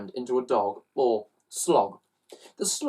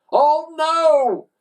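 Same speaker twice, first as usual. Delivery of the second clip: higher, louder Oh no; exclaiming; 7 dB louder than usual. Acted. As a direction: cry out in dismay. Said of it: "exclaimed Slime".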